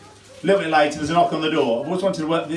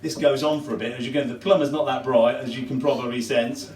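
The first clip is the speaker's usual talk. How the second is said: ordinary delivery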